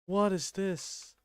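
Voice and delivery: ordinary delivery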